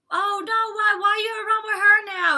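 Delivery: high, loud whining mimic of a crybaby; mock Kiki crybaby whine; whiny crybaby. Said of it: "Kiki crybaby whining ass".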